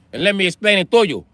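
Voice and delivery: usual speaking voice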